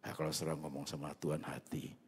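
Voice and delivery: ordinary delivery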